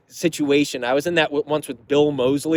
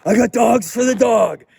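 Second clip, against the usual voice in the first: higher, louder eager fan calling out; mock excited fan, pitched up and loud; 6 semitones higher, 5 dB louder than usual. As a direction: play the overexcited guy with hot dogs. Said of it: "He's like".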